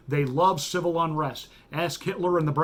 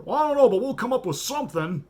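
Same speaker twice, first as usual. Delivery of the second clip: mocking activist voice; 6 semitones higher, faster than usual. Mock quote of activists.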